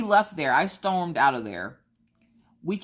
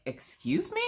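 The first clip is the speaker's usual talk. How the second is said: sharply higher, offended excuse me; indignant disbelief; 4 dB quieter than usual. Retold or imitated. Mother to the hairdresser refusing her son, higher indignant disbelief. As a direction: throw it back in stunned outrage. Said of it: "Y'all, I was so mad".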